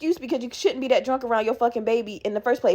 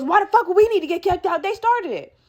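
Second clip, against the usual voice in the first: mock outraged mom, pitched up; indignant protest; 6 semitones higher than usual. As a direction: imitate an indignant mom, higher voice. Mom protests being kicked out.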